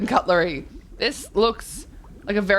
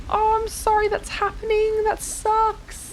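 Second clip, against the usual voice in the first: high, squeaky sympathy; a cartoonish high voice of pity; sympathetic squeak; 13 semitones higher than usual. Streamer consoling a viewer in a high squeaky voice.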